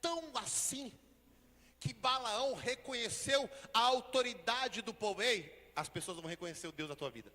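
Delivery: loud, slow, sung-out preaching; preaching at full force. Real, not acted. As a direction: proclaim blessing over the church.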